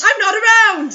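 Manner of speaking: joking yell; playful outcry; mock exclaim.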